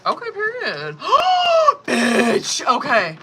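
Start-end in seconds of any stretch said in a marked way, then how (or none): 1.0-1.8 loud high gasp of shock; sharp gasp. Real, not acted. Dramatic card reveal. 1.9-3.2 loud, thrilled outburst; excited exclamation. Real, not acted. Reacting to the card reveal.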